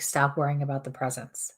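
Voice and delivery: usual speaking voice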